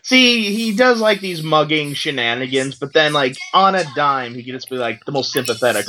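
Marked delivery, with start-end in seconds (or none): none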